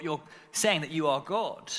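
ordinary delivery

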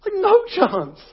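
scoffing dismissal. Real, not acted. Mocking the overconfident boxer.

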